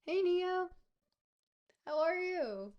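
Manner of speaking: high, loud happy hello; light up and call out a greeting; excited greeting. Real, not acted.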